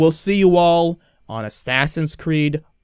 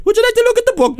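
very high squeaky put-on voice; interrupting book pusher; 4 dB louder, faster than usual. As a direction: cut in with a relentless high-pitched offer. Repeated interrupting catchphrase.

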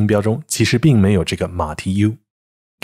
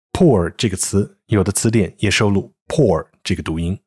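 ordinary talk, nothing marked

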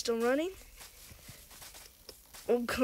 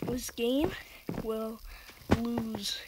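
spooky whisper; 6 dB quieter, much slower than usual. Acted. Ominous in-game threat.

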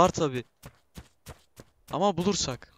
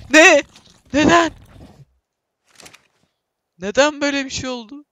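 high, loud startled questions; stunned what-just-happened; shocked disbelief; 10 semitones higher, 8 dB louder than usual. Real, not acted. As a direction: cry out in disbelief. Sudden bad game outcome, disbelief.

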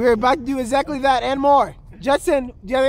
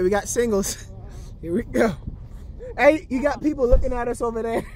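usual speaking voice

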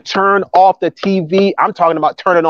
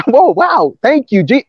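lightly acted, higher-pitched wonder; mock amazed thanks; 6 semitones higher than usual. Believer rediscovering how to thank God, acted happy amazement. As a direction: play at being pleasantly amazed.

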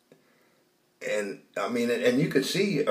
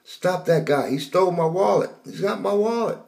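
yelling; 10 semitones higher than usual. Retold or imitated. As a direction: yell after the thief to stop him. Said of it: "I started yelling".

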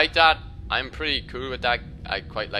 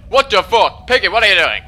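loud, high startled yell at the pig; shocked shout; 7 semitones higher, 15 dB louder than usual. Real, not acted. A startled gamer yelling at his pig Peggy, loud and shocked.

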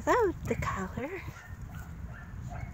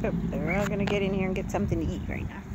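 normal talking voice

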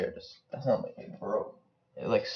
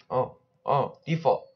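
normal talking voice

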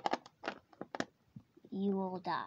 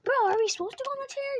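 alarmed fast question; 7 semitones higher, much faster than usual. Real, not acted. Panicked question in game.

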